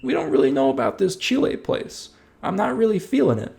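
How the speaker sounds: French settlers voiced high and wary on arrival; doubtful French settlers. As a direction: play a hesitant settler unsure of the place. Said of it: "and they were like".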